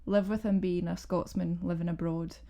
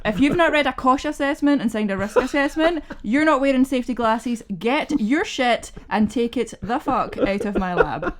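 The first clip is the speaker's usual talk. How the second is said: loud furious scolding, pitched up; furious scientist kicking careless visitors out of her lab; angry lab boss; 4 semitones higher, 8 dB louder than usual. Retold or imitated. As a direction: bellow at them to get out of the lab. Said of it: "That's what I would say".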